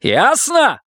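high, insistent repeat; sharp demand. A narrator's reading. Husband forcing wife to answer, threatening.

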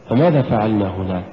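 normal talking voice

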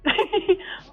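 high-pitched giggle; amused laugh. Real, not acted. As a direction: burst out giggling at the caller. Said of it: "вам смешно?".

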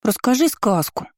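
put-on high childish demanding voice; whiny child begging for a story; little girl voice. A narrator's reading. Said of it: "требовала она у матери". (капризный детский голос)

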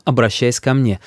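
ordinary delivery

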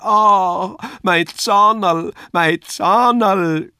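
drawn-out high, loud puppet howl; Kasperl wailing. A narrator's reading. Kasperl howling over his tooth, high, loud, drawn-out wail. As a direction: wail pitifully, stretching each cry way up high. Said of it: "schrie Kasperl".

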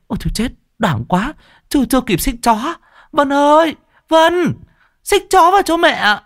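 high panicked shriek, calling to her daughter; fast flustered cry for help; shrieking in alarm. Acted. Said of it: "Bà Dậu trợn mắt rú lên". (rú lên hốt hoảng, giọng cao vút)

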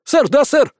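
high, crisp yes-sir; military acknowledgement. A narrator's reading. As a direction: answer like a soldier at attention. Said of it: "вытянулся Никита". (бодрый солдатский ответ)

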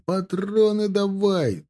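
high, drawn-out call; shouting. A narrator's reading. Dragoons demanding cartridges in battle, high drawn-out shout. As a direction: stretch it out, calling for supplies. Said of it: "кричали драгуны".

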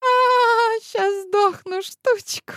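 high, laughing, groaning through tears of mirth; moaning with laughter. Acted. Astrologer crying with laughter at granny's joke, high and squealing. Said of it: "Простонала Вангелия, вытирая со смеющейся физиономии слёзы".

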